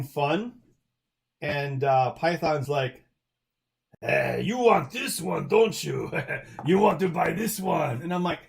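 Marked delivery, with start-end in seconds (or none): 4.0-8.0 slow, teasing villain drawl; Bond villain voice. Retold or imitated. Python pushing a sign at his table, mock Bond villain. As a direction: lightly sell it like a sly movie villain. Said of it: "Seriously, he talked just like that".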